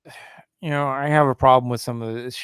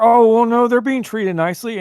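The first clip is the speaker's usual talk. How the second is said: mocking quote; 9 semitones higher, 3 dB louder than usual. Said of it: "fighting over".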